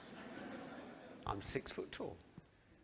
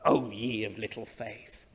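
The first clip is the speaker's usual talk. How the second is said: stern scolding read; 4 semitones lower than usual. Shown as a demonstration. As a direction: scold them gently but firmly. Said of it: "You could read this in a scolding tone".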